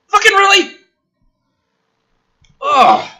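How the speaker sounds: can't believe this is in the script; a loud, cracking "really?" of disbelief; exasperated disbelief. Real, not acted.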